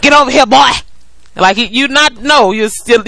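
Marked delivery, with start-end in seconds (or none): 0.0-0.7 higher mock southern parent yell; southern accent. Co-host mimicking the father's discipline, mock southern accent. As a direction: imitate a southern coach ordering a kid over. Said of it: "You get a southern accent".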